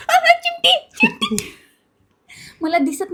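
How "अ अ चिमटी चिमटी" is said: very high squeal, slow; high yelp of pain, drawn out. Real, not acted. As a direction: squeal as if pinched. Blindfold pinching her.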